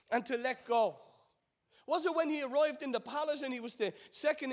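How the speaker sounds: high, driving sermon delivery; impassioned preaching. Acted. Preacher urging the congregation through Joseph's journey, impassioned. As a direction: preach it with rising and falling emphatic waves.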